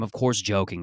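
ordinary delivery

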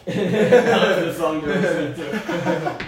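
laughing. Real, not acted. Describing a troll video to friends, laughing. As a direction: laugh through it.

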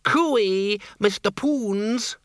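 high, drawn-out sing-song call across a crowd; vampires' cooey call. A narrator's reading. Vampire couple hailing Windle across the crowd, long high cooey. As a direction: sing it out long and high to catch his attention. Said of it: "the cry of the vampires".

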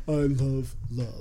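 lightly voiced lovesick stalker; imitating the guy from You. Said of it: "you sound like ... from that show, You".

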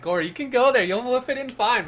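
high, loud mocking quote; squeaky mimic of a friend. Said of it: "I love how you're like".

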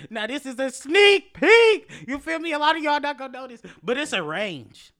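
very high, very loud hype; hyped up. Real, not acted. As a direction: sell the secret with hype. Hyping a sneak peek.

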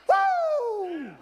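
high piercing wrestler's woo; Ric Flair woo. Retold or imitated. Pastor hollers at the congregation like a wrestler, piercing woo. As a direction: let rip a wrestling-promo woo. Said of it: "let me Ric Flair that for a minute".

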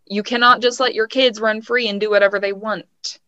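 ordinary talk, nothing marked

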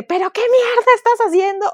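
high, loud furious yell; furious shout. Retold or imitated. Woman snapping at the client who threw her slides, furious shout. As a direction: explode at him, screaming. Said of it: "pero gritando como loca".

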